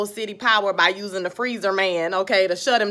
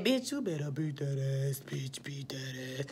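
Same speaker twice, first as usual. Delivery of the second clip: hushed, drawn-out whisper; whispered cheering; 8 semitones lower, 15 dB quieter than usual. Retold or imitated. Viewer egging Lynn on in the fight, intense whisper. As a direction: whisper your hype for the fighter.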